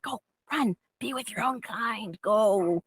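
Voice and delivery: soft mock-solemn release; hushed dramatic shooing. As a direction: release them like a wild animal, softly.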